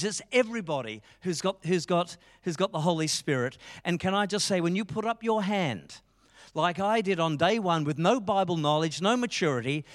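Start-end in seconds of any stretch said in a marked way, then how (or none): none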